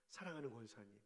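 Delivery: soft, low, loving address; tender and soft. Shown as a demonstration. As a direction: say it gently like a caress. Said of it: "마음이 포근해지는지". (부드럽고 낮게 다정히 부르는 말)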